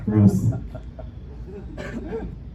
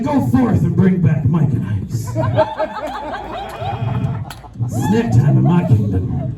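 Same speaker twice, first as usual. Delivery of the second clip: regal command; slower than usual. Retold or imitated. Comic plays a queen commanding her teenage minion, slow and grand. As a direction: order the minion like a mock queen. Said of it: "I can be like".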